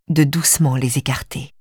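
ordinary delivery, nothing marked

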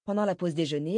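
ordinary delivery

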